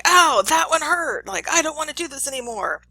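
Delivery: high, loud acted protest; pained complaint. Teacher plays shocked learner complaining from next room, high pained protest. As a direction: cry out in pain, then plead to stop. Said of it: "sounds of complaint coming from Mister Johnson".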